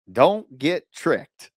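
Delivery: very slow, deliberate don't get tricked; slow repeated warning. Shown as a demonstration. Coach repeating warning to buyers, slowly drawn out. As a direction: drag out don't as a stern warning. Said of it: "do not do this".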